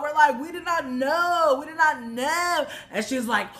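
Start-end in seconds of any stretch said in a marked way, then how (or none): 0.4-2.7 high, slow, stretched-out protest; protesting innocence. Retold or imitated. Friends answer the yelling woman from the car, protesting innocence. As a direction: call it back emphatically from a car.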